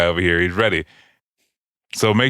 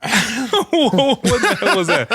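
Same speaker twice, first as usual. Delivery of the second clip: high, slow laughing question; gasping it out through laughter; 12 semitones higher, much slower than usual. Real, not acted. Host laughing at the guest's strange noise.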